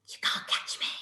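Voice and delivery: a teasing breathy whisper. Acted. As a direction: whisper it like a playful dare. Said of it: "and whispered".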